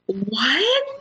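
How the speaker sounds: high-pitched, incredulous questioning; stunned, stammered repeats climbing high; horrified disbelief. Retold or imitated. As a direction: reenact being appalled and baffled, voice shooting up. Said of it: "I'm horrified".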